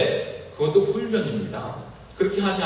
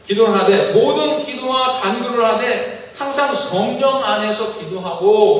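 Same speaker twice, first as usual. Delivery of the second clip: raised, declaiming verse reading; solemn Bible verse read aloud; scripture reading; 6 semitones higher, 4 dB louder than usual. Acted. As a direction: proclaim the verse from the pulpit.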